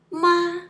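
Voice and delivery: ma held high and level, loud; first tone. Shown as a demonstration. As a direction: sustain ma on one high note. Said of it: "It's different tones".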